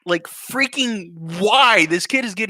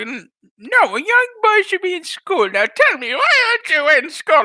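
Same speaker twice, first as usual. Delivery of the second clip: put-on old lady voice; 11 semitones higher, 4 dB louder than usual. Old lady scolding the limping boy, high. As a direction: quaver like a nosy old woman.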